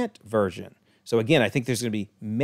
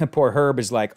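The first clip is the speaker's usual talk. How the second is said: usual speaking voice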